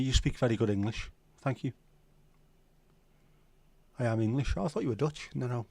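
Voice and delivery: ordinary delivery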